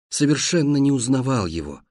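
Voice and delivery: usual speaking voice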